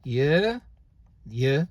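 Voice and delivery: "y" said alone as a drawn-out syllable; pronunciation demo. Shown as a demonstration. Teacher sounding out the first syllable, careful pronunciation demo. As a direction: isolate the sound "y" slowly for learners.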